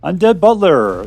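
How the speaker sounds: ordinary talk, nothing marked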